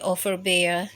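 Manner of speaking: ordinary delivery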